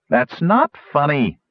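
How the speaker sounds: complaining. A narrator's reading. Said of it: "he complained".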